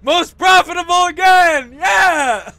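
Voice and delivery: high, loud cheer; triumphant shout. Real, not acted. Player celebrates being most profitable, triumphant shout. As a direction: shout your win to the crew.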